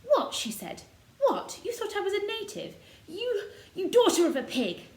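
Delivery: furious high-pitched insult. A narrator's reading. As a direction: spit it out in outrage. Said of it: "Mary sat up in bed furious".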